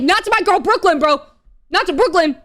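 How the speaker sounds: over-the-top fan indignation; mock protective outrage. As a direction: defend your favourite with hammed-up outrage. Acting out fierce protectiveness of Brooklyn.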